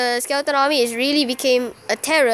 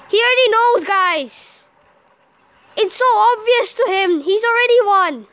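high, loud cry of defeat; exasperated outcry; 7 semitones higher, 4 dB louder than usual. Real, not acted. Player telling viewers the opponent has won, exasperated outcry. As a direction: protest that it is hopeless.

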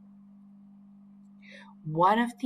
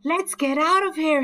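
high, louder urgent call; boy fleeing a dinosaur, raised call; 11 semitones higher, 7 dB louder than usual. A narrator's reading. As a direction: call the others to run. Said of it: "he called".

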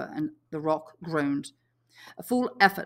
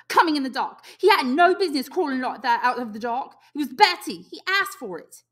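high, shrill and louder; shrill self-justifying cry; 9 semitones higher, 8 dB louder than usual. A narrator's reading. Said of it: "His voice shrilled again".

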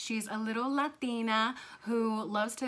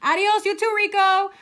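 put-on high, loud kid heading out; breezy parting call; calling goodbye; 11 semitones higher, 15 dB louder than usual. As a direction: holler a bright farewell. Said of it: "she called as she started out".

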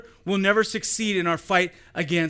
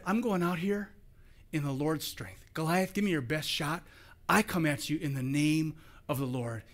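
soft, much quieter steady faith; quiet confidence; 4 semitones lower, 7 dB quieter than usual. Pretend. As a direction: say it low and sure, no bluster. David's real words, quiet confidence.

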